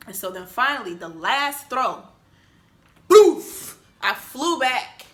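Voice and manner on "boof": high, loud crash sound; loud impact boom. Retold or imitated. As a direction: slam out the impact.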